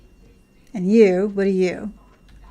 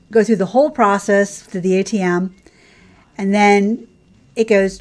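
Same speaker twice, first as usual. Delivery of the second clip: ordinary talk, nothing marked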